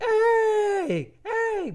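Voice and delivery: high-pitched imitated yell; retelling a woman yelling over the fence; mock yell. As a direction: shout hey in a shrill mock call. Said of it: "she was yelling like".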